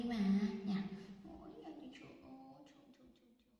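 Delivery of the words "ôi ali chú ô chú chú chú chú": very soft, fading calls; soft calling. Real, not acted. Mother softly calling the cat over, very quiet. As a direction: coax the cat with tiny calls.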